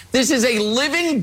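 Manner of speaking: usual speaking voice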